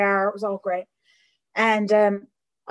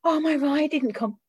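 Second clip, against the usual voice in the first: whiny sponsee excuse; 5 semitones higher than usual. Retold or imitated.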